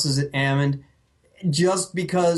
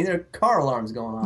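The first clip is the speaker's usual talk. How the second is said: ordinary delivery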